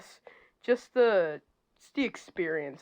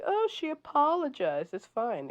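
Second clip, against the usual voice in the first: mock dismissive, higher voice; mocking quote; 9 semitones higher than usual. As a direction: parrot them in a silly dismissive tone. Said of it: "be like".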